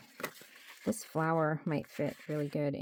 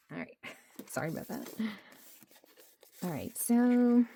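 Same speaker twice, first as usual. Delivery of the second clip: usual speaking voice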